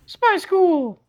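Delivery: put-on very high, eager child's shout; excited kid. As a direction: blurt it out like an excited eleven-year-old. Child picks between camps.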